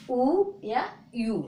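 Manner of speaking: slow, drawn-out ऊ and यू, like a teacher modelling each sound; two ways to say u. Shown as a demonstration. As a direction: spell out ऊ and then यू slowly for the class. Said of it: "यू का उच्चारण क्या बोलते हैं".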